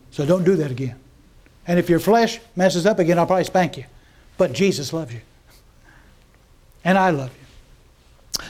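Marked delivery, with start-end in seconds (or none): 4.4-8.4 tender reassurance. Real, not acted. Preacher reassuring his flock after a joke, slow and tender. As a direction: say it slowly, like a gentle promise.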